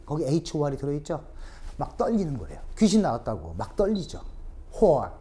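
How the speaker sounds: ordinary talk, nothing marked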